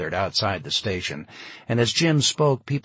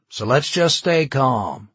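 loud, slow and higher; announcing; 4 semitones higher, 7 dB louder than usual. A narrator's reading. Charlie tells the crowd to stay calm, announcing loudly. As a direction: address the crowd slowly and loudly. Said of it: "Charlie announced".

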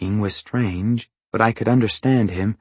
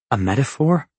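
higher, rising incredulous question; puzzled echo; 3 semitones higher than usual. A narrator's reading. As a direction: query it in disbelief. Narrator echoes Longface's claim in disbelief.

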